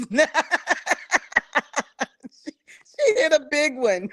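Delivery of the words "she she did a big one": words through laughter; laughing. Real, not acted. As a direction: laugh while saying it. Laughing hard at the host.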